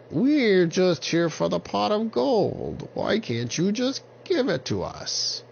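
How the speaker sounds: high witch voice; squeaky high witch, whining. Acted.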